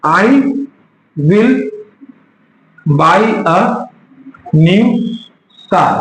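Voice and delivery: I... will... buy a... new... car, long pauses between; dictating word by word. Shown as a demonstration. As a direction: break the sentence up for writing down. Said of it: "example लिखो".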